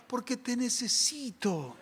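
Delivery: very soft prayer; almost under the breath, pleading with God; tender, quiet admission of need; hushed heartfelt plea. Acted. Voices a prayer crying to God.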